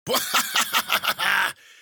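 loud, high witch cackle; big shrieking guffaw; bursting out laughing. Acted. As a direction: explode into a long cackle. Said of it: "She burst out laughing".

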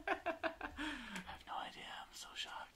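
breathless whispered giggles; can't stop laughing, barely voiced. Real, not acted. Stumped for a name, losing it in whispered giggles. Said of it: "I'm losing it".

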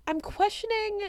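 "I'm questioning" swooping up high, puzzled; quizzical high. Real, not acted. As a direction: lift "questioning" way up like a baffled question. Repeats phrase, first take pitched high.

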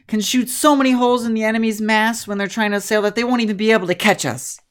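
pep her up, eager to be hired; spunky young trainer. Acted. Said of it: "She goes".

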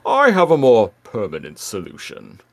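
hammed-up villain voice; mock-menacing bad-guy impression; Megatron. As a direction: put on a theatrical villain's voice. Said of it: "And he's like".

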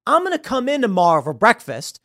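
put-on a friendly but firm local cop telling the kid off; stern cop. Small-town cop scolding a kid.

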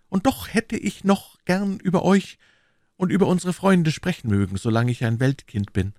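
lightly voiced wistful musketeer; Aramis. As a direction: gently admit a lingering worldly interest.

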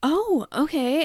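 slow, hammed-up bewilderment; overdone charismatic husband. Pranked husband performing for views, hammed-up surprise. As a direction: play up fake confusion for the camera. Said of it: "being so charismatic".

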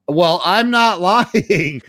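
high, drawn-out mock protest; insistent protest. Accused customer insisting to psychiatrist, exaggerated indignant protest.

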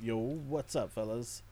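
lightly voiced toy figure greeting; soft playful figure voice; gruff tinny action figure. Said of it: "Action Figure Bancho says".

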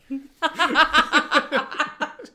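high, loud burst of laughter. Real, not acted.

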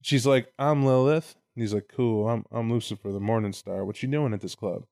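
low, quiet, smooth come-on; lightly voiced slick devil at a club; a hint of a lounge lizard devil; smooth Lucifer pickup. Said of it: "and Lucifer's like".

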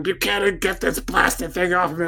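high, indignant hag's yell. Acted. Game master's hag orders Buchanan to free her, high mock yell. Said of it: "The hag's yelling".

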